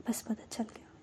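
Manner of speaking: whispered tenderly, almost no voice; lovingly. Acted. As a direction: breathe it softly, full of love. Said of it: "बड़े प्यार से कहते कि". (बड़े प्यार से फुसफुसाकर)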